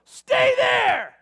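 very loud, high reenacted yell; furious shouted order. Dad to screaming daughter in her bed, furious shout. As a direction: yell it at full force.

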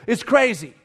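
ordinary talk

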